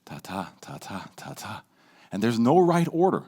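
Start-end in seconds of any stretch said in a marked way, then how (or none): 0.0-1.6 quiet, varied beats; soft rhythmic ta ta. Shown as a demonstration. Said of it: "you go like this".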